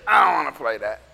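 low, grumbled no; dismissive mock-child grumble; sulky kid refusal. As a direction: sink the pitch and refuse.

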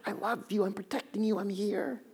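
soft, hushed reassurance; little whisper. Acted. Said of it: "You'll hear his little whisper".

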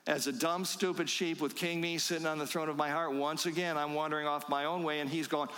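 normal talking voice

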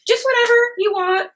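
very high-pitched, hammed-up people-pleasing; meek mock reply. Photographer who set no price to a client, meek. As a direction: squeak it out, letting the client decide. Acting out not setting a price.

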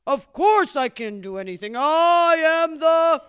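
loud bragging bellow climbing high; boastful Great Bear. Acted. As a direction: boom it out and stretch the name.

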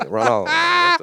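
sudden high squeak; high squeaky voice. Retold or imitated. Narrating nature documentary scene.

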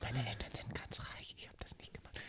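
whispered joking aside. Pretend. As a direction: drop to a secret whisper.

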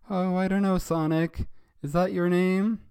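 high, loud cartoon girl; hesitant question in a high put-on voice; Lisa. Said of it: "And Lisa replied".

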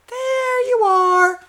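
very high and drawn out, slow; slow mock squeak. Mocking his partner's greeting, stretched high and slow.

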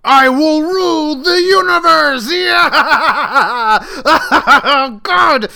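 high shouted villain rant; megalomaniac rant. Acted. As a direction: scream it like a mad overlord.

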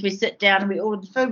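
ordinary talk, nothing marked

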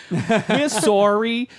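a slow, high and loud Canadian sorry; Canadian accent. Retold or imitated. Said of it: "But in that accent".

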